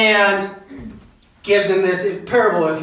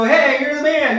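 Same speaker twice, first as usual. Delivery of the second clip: quick, higher-pitched congratulatory call; casual praise; 5 semitones higher than usual. Retold or imitated. People today cheering a buddy, casually admiring. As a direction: toss out a breezy compliment, imitating modern slang. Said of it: "positive connotation".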